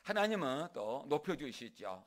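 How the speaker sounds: ordinary talk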